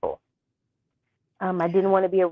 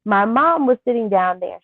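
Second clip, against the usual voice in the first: ordinary talk, nothing marked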